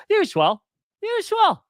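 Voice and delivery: mock Irish accent, cringing at yourself; bad Irish accent. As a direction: sheepishly replay the fake Irish accent. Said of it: "I just said".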